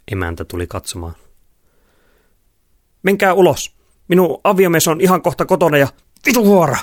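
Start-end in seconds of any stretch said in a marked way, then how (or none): 3.0-5.9 high, loud panicky order; alarmed housewife. A narrator's reading. 6.3-6.8 very high, loud cursing yell; furious shout. A narrator's reading. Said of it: "Loviisa huusi".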